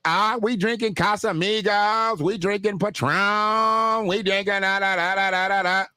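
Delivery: high, level chant trailing into da-da-da; mock party girls. Mimics women answering the old man.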